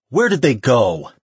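loud, high, demanding question; angry growl. A narrator's reading. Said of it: "she growled".

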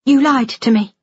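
high-pitched, hurt accusation; wounded, insistent daughter; accusing. A narrator's reading. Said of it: "Jennifer repeated".

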